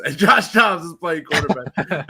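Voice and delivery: slow mocking voice, laughing; mock his reasoning through laughter. Mocking friend's logic, laughing.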